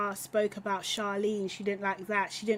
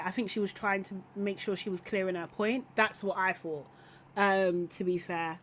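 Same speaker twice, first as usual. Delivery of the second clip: usual speaking voice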